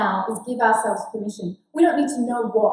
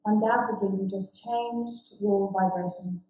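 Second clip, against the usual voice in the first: guided meditation; 8 dB quieter than usual. Acted. Facilitator guiding the group with closed eyes, soft meditation voice. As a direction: guide them into calm, softly.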